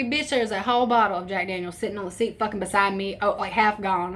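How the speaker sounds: usual speaking voice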